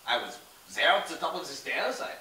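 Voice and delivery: a con man playing a Frenchman, French accent throughout; fake French accent. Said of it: "That doesn't sound very French, Pierre".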